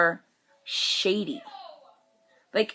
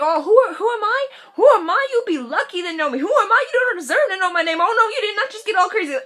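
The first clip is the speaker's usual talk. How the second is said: high, loud diva comeback; sassy indignation; 10 semitones higher, 4 dB louder, faster than usual. Acted. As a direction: put them in their place, pitched way up. Acted diva comeback to commenter.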